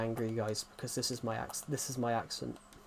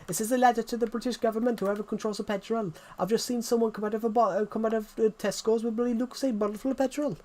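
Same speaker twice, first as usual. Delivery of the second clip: high, fast Welsh rant; Welsh accent; 9 semitones higher, faster than usual. Acted. Imitating the guy who talks to the government on TikTok, Welsh accent. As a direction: imitate a Welshman complaining at speed, pitched well up. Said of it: "Welsh accent's a bit like this".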